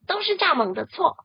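高而响的群体怒喊; 一群动物一起冲着河马喊; 齐声吼叫. Acted. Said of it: "都生气地大声吼叫". (大声怒吼)

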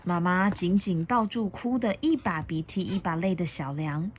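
ordinary delivery

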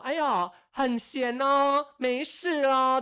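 slow, drawn-out mocking jeer, pitched high and loud; loud sarcastic taunt. Coworker loudly mocking the narrator for slacking, drawn-out jeer. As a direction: sneer it out loud so the whole office hears. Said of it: "旁边很大声说".

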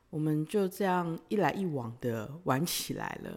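normal talking voice

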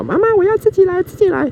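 put-on high, pleading little kid; child voice. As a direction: beg like a small child wanting to do it alone. Child begging parent to do it.

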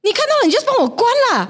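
high, exasperated mock retort; imitated quarrel. Imitating partner's retort in a quarrel over lids, exasperated. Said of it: "we quarreled once very badly".

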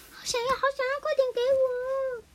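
put-on high, slowed pleading child voice; begging a grown-up for the treat; 急切的小孩. 绿豆冰急着要药丸. (高音拖长的童声，急着讨要)

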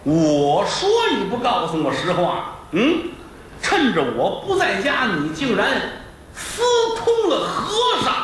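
hammed-up jealous husband; mock outrage, rising to a shriek; furious accusation. Husband accuses wife of affair.

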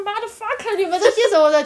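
high mock English cursing; imitating drunk rant. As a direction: ham up the drunk girl swearing. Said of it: "就突然一直讲英文". (高声夸张地模仿醉女骂人)

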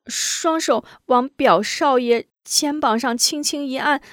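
high halting servant voice; high nervous maid recounting; hesitant maid. Acted. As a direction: tell the tale haltingly. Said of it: "吞吞吐吐说了起来".

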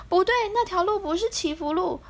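high, fast and urgent; hurried. Acted. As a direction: blurt it out in a rush. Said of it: "福龟急忙摇头说".

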